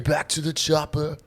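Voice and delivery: bellow-style movie line in a mock Austrian accent; hammy Austrian-accented impression; Arnold Schwarzenegger. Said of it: "Arnold Schwarzenegger".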